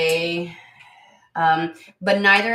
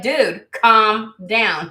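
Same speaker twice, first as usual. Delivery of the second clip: slow, louder calm down; slow exasperated; 4 semitones higher, 8 dB louder, much slower than usual. Retold or imitated. Mother telling oldest son to calm down, slow exasperated. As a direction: drag it out to settle him. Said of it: "I was like".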